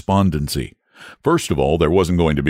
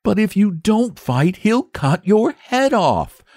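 put-on high, slow, alarmed young boy; gasping boy; 13 semitones higher, slower than usual. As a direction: blurt it out in dismay. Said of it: "gasped the boy".

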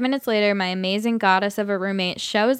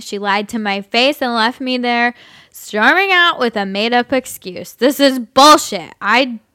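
put-on loud, slow drunken rant; 5 dB louder than usual. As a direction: rage like an angry drunk. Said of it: "I'll just do my drunk voice".

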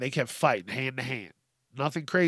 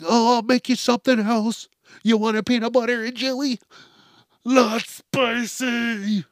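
much higher, louder put-on coddling voice; mocking doting wife; 8 semitones higher, 4 dB louder than usual. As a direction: coo it like a mother spoiling a child.

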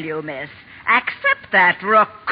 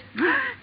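high sharp gasp of outrage; an offended gasp; indignant gasp; 7 dB quieter than usual. Acted. Aunt March outraged.

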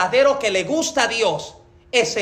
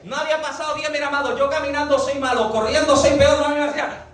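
normal talking voice

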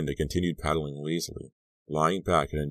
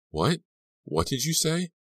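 startled; 12 semitones higher than usual. A narrator's reading. Shocked by friend's coma joke.

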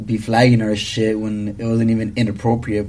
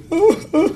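high laugh. Real, not acted. Laughs at joke.

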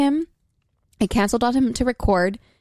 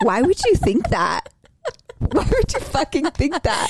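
slow, drawn-out outrage that climbs higher; mock indignant; 5 semitones higher, slower than usual. To guys who took their word seriously, slow mock outrage. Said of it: "And we're like".

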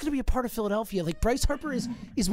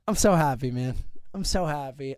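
heartfelt; 7 semitones lower than usual. Real, not acted. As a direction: thank them from the heart. Emotional thanks.

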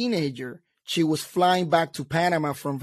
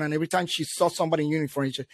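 ordinary talk

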